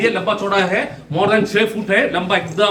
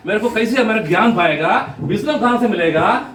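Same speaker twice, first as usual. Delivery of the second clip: normal talking voice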